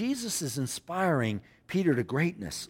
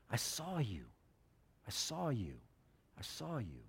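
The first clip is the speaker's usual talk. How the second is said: very soft, tender repetition; hushed reassurance; 3 semitones lower, 14 dB quieter than usual. Acted. Jesus to one who had no friends, hushed and tender. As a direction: let it sink in, almost under the breath.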